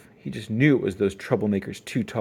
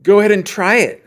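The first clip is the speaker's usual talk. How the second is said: very loud, higher taunting challenge; loud dare; 9 semitones higher, 13 dB louder than usual. Acted. Bully daring the brother, very loud taunt. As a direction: shout the dare at him.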